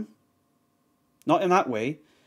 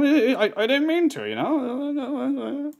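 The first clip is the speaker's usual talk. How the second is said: grovelling streamer; 10 semitones higher, slower than usual. Retold or imitated. Mocking the streamer apologizing to the dad, high grovelling whine. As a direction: squeak out a cowardly sorry.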